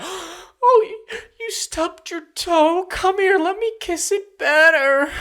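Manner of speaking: shrieky-high, loud, hammed-up coddling; mock Boba Fett. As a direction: fuss over a tiny injury in a very high voice. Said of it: "This Boba Fett in the T V show would be like".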